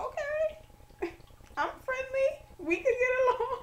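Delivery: tiny high-pitched eager voice; eagerly agreeable. Retold or imitated. Student accepting an unknown roommate, squeakily agreeable. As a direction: squeak it sweetly, giggling between phrases.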